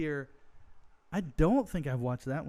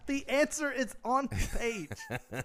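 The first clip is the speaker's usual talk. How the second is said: high, drawn-out, laughing outcry; laughing, slow and incredulous; laughing disbelief; 8 semitones higher, slower than usual. Real, not acted. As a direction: blurt it out through laughter. Laughing over his own blunder.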